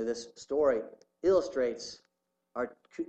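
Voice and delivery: usual speaking voice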